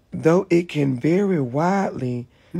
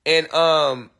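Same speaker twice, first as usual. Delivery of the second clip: usual speaking voice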